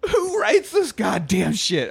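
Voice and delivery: high, drawn-out comic exasperation; exasperated outburst. Real, not acted. Exasperated outburst at bad script.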